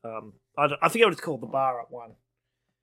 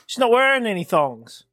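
higher, louder mock protest; retold comedy routine; 7 semitones higher, 6 dB louder than usual. Retelling a thong mix-up, lightly acted protest. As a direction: act out the comic's puzzled objection.

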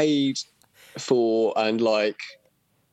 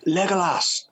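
mock jeering call; 6 semitones higher than usual. Retold lads accosting him in street.

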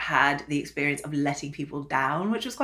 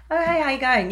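Australian-tinged casual greeting, pitched up; breezy chat-up line drifting into Australian; Australian accent; 6 semitones higher than usual. Acted. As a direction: slip into an Australian accent by accident. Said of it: "that's Australia".

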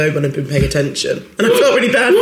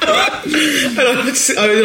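laughing; 6 semitones higher, slower than usual. Real, not acted. Laughing at childhood plum fear.

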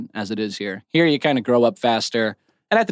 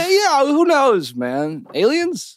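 much higher, playful silly guesses; high joking voice; 7 semitones higher than usual. Pretend. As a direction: squeak out the guesses as a joke. Joking guesses about why he came.

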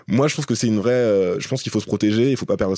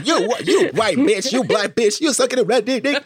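mock American accent like a senator from The Wire, pitched way up. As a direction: ham up a thick American accent, high and ranting. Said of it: "comme ça avec son accent".

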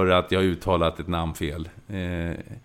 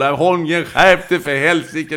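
loud, slow, high mock rebuke; scolding tweet; 8 semitones higher, 9 dB louder, slower than usual. Quoting an angry Iranian football fan, loud and slow. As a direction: read the angry fan's tweet with scorn. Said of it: "fick ju på Twitter".

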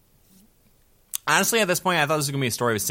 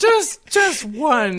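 very slow, higher, louder begging; mock plea; 13 semitones higher, 6 dB louder, much slower than usual. Joking friend begging for one popcorn, slow and high. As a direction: drag out a hammed-up plea.

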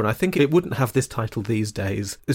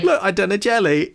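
high, mock-proud boast; Aunt Mary; 7 semitones higher than usual. Podcaster mocking Aunt Mary's pride in her jelly, mock proud. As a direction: show it off with hammed-up pride. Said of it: "really proudly".

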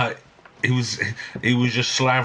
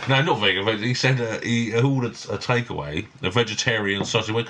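ordinary talk, nothing marked

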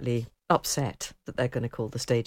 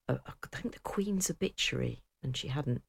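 whisper; 8 dB quieter than usual. Real, not acted. Confiding the BBC's obituary blunder to co-host, whispered. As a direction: drop into a whisper.

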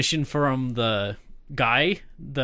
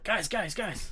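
much softer urgent calling; hushed calling; 4 semitones higher, 11 dB quieter, slower than usual. Retold or imitated. Zia runs out with paper to get friends' attention, hushed. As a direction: call them over under your breath.

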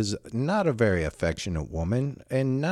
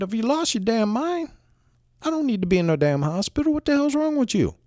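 pitched-up, fast exasperated tirade; angry outburst; 13 semitones higher than usual. Retold or imitated. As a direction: let her have it, incredulous and mad. Angry outburst at his wife.

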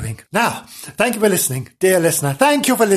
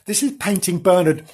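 ordinary delivery, nothing marked

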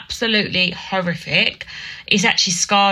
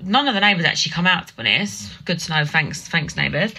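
ordinary talk

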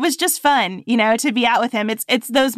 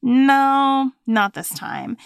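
persistent little voice; 6 semitones higher, much slower than usual. Acted. Voice that talks you out of plans, persistent nagging. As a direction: stretch it out as an imagined nagging voice. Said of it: "that persistent little voice that tells you".

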